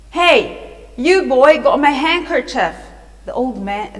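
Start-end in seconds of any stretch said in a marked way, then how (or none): 0.1-2.7 loud, raised accusing call; angry shout. A narrator's reading. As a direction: shout after a pickpocket. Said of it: "the old man just shouted".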